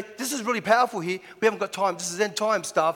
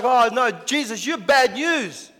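upset farmers; 5 semitones higher than usual. Retold or imitated. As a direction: drag out the dismay like a ruined farmer. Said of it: "They're like".

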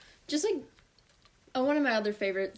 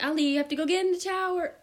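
high mock call to a child; yelling mom; 8 semitones higher than usual. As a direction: imitate a mom calling her kid to wash. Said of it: "she started yelling for me".

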